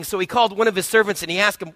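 usual speaking voice

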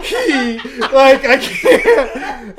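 high, loud words breaking into laughs; helpless laughter. Retold or imitated. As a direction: try to explain while cracking up. Too amused to tell mom.

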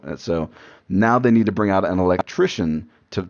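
ordinary delivery

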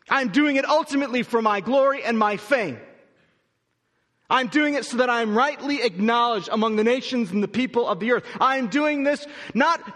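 a hint of God speaking, loud and high; voicing God. Preacher voicing God to Israel, loud and high. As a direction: proclaim it as God would. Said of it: "God is saying".

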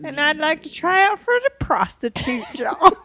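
high put-on old lady voice; hammy old woman audition; Esther voice. Acting as Esther applying.

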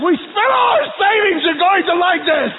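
high, loud, frantic mock-dad outburst; exasperated shout. Dad dragging crying children, hammed-up exasperated shout. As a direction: act out a parent losing it.

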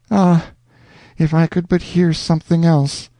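a weary, tearful wish; anguished plea. A narrator's reading. Worn-out Alonzo begging for a different song. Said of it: "shed tears of anguish and say".

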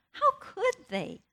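incredulous, hurt protest, rising high; indignant disbelief. Retold or imitated. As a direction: voice her wounded indignation at being hurt. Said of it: "My reaction is".

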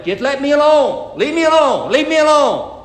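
high, loud, fed-up leave me alone; exasperated outburst. Retold or imitated. A wayward child shouting at family, exasperated. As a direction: push the helper away, voice climbing. Loved one pushing helper away.

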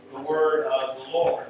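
ordinary talk, nothing marked

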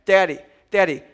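put-on whiny kid voice calling Daddy; child calling daddy. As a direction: play the kid pestering dad. Kids calling for dad.

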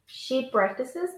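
normal talking voice

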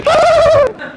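very high squeal of delight; imitated overjoyed scream; excited shriek. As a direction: let out a sudden high shriek. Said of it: "She's excited".

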